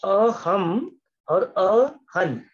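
अहम ending on m, then अहन ending on n, each very slow; slow teacher's demo of अहम against अहन; slow contrasting pronunciation. Shown as a demonstration. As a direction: spell out अहम and अहन sound by sound so the m and n endings differ. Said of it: "न से भी pronounce कर सकते हैं".

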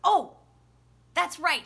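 usual speaking voice

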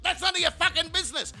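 high-pitched mock-aggressive retort. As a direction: shut them down in mock outrage. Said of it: "I came across so aggressive".

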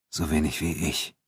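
ordinary delivery, nothing marked

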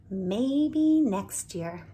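slow, drawn-out wistful line; a lightly played wistful sigh of hope; soft wistful. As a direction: linger on it with quiet hope. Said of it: "she said softly".

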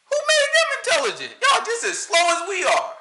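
high, incredulous rhetorical jab; indignant outburst. Real, not acted. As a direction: demand it with laughing outrage. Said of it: "indignant rant".

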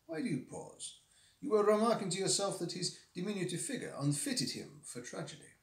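a hint of French accent; toned-down French accent. Dupin explaining to the narrator in a French accent. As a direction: ease the French accent back. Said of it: "Tailor down the French accent".

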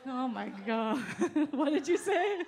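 ordinary talk

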